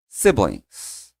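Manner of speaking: siblings said slowly, SIB-lings falling; model pronunciation. Shown as a demonstration. Teacher models siblings for students to repeat. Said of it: "repete comigo".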